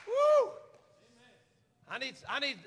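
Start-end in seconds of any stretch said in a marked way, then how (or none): 0.1-0.5 high, loud "woo"; exultant whoop. Real, not acted. Preacher after rousing the church, high exultant whoop.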